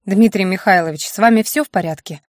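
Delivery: ordinary delivery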